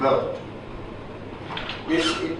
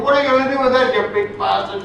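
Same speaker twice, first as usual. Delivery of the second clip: high, cheeky taunt; mock challenge; 9 semitones higher, faster than usual. Soldier taunting a six-foot-four MP, cheeky and high. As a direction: taunt the big MP, pitched up.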